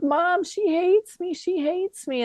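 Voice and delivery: a touch of a hurt daughter's plea. Retold or imitated. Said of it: "her lips quivering".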